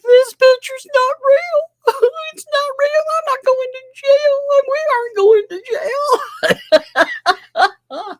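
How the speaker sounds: very high, squeaky bawling, hammed-up; mock crying. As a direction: wail it like a blubbering crybaby, mocking him. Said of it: "he was crying in post".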